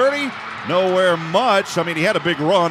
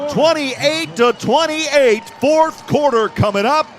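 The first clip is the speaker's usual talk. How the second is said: higher, slow, drawn-out score call; announcer hyping overtime to listeners; excited call; 5 semitones higher than usual. Real, not acted. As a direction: stretch it out for the crowd. Calling overtime.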